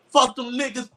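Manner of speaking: normal talking voice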